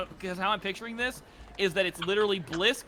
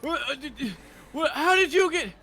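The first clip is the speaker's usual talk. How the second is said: higher, louder sputtering guard; raised, flustered voice; baffled guard; 9 semitones higher, 4 dB louder than usual. Acted. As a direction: stammer it out as a confused guard.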